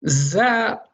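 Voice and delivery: Za said with a light open a; Za as a clear demo sound; Arabic letter za. Shown as a demonstration. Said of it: "Za versus ra".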